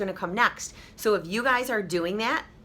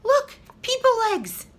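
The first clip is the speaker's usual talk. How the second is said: high, loud amazed mother's voice; delighted mother; 14 semitones higher, 4 dB louder than usual. Acted. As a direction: marvel at the baby like a happy mom. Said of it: "Kristen's mother ... said".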